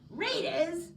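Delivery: high, loud incredulous bark; barked question. A narrator's reading. Director barking at Andy about readers. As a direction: bark the word back in disbelief. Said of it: "barks Mister Big Shot".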